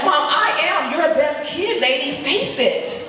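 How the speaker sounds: insisting to mom. Retold or imitated. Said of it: "I'm like".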